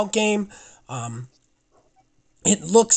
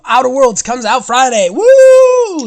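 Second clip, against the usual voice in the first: loud, high whooping excitement; super excited; 7 semitones higher, 11 dB louder, slower than usual. Real, not acted. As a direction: burst into a big cheer of excitement. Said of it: "Super excited about this game".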